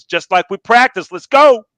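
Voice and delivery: loud shout of encouragement, building; cheering mom. Retold or imitated. Mom urges her kid at the foul line, cheering shout. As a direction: yell it from the bleachers, rising to the end.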